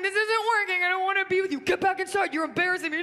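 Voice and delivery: high, loud hammed-up breakup speech; high melodramatic girlfriend; breakup drama. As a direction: play it big for the audience. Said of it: "I'd be like".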